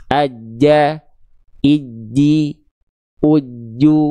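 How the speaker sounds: "aja, iji, uju" with a held stop before j releases; doubled jim drill. Shown as a demonstration. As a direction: hold the tongue contact mid-word in "aja, iji, uju". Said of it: "Seperti itu huruf jim dilafalkan".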